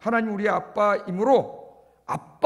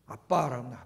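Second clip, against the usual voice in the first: ordinary talk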